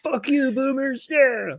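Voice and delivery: high, drawn-out celebratory jeer; gleeful mock cheer. Millennial celebrating the housing crash, gleeful high-pitched cheer. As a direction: crow it high and stretch it out.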